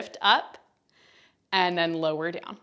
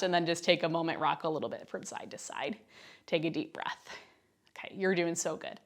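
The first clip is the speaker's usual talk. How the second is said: quiet, gentle encouragement; soft and calming; 2 semitones lower, 10 dB quieter than usual. Real, not acted. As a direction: ease them down softly. Cooldown after effort, calming.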